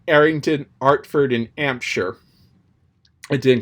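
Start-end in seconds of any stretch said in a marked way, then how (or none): 0.1-2.2 "Harrington, Hartford, and Hampshire" with no h, raised and louder; Cockney flower girl dropping her h's; Cockney, h dropped. Retold or imitated. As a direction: Eliza dropping every h. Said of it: "she was like".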